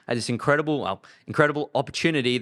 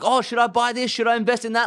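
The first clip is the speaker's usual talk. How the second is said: clueless investor; 8 semitones higher than usual. Retold or imitated. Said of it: "They're like".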